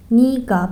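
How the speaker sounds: نیگا said as "ni-ga" with an i sound, not "ne-ga"; pronounced niga. Shown as a demonstration. Teacher to students, showing نیگا said ni-ga. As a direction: say نیگا clearly as ni-ga. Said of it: "موقع تلفظ کردن باید بگین نیگا".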